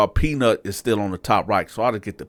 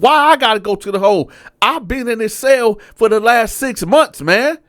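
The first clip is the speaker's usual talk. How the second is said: high, loud indignant complaint; angry protest; 12 semitones higher, 5 dB louder than usual. Retold or imitated. Inmate protesting to the sergeant, high and indignant. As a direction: argue back hard at the sergeant. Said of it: "I said".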